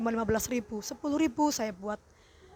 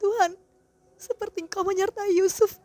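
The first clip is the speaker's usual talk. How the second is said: higher, louder tearful prayer, pleading with God; fervent prayer; 6 semitones higher, 5 dB louder than usual. Real, not acted. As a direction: pour it out to God, voice near tears.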